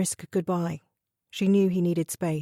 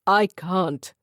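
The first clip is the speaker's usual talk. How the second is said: 1 choked up; 5 dB louder than usual. A narrator's reading. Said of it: "strange guttural sound".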